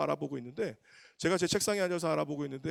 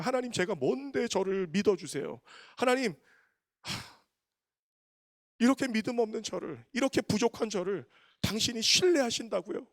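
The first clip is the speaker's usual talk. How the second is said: higher, choked, disbelieving plea; tearful prayer; 6 semitones higher than usual. Retold or imitated. Pastor cries out to God in humbled, tearful wonder. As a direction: plead with God, overwhelmed and near tears.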